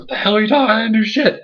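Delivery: denying the blame, way up high; outraged denial. Retold or imitated. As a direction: squeal your innocence. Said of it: "Daniel Bryan's like".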